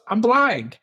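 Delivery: high-pitched comic alarm; mock panic. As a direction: imitate a panicked realization, played for laughs. Retold panicked first thought on waking.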